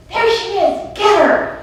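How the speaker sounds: high, loud cry to charge; acted attack shout. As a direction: shout it like a mock ambush.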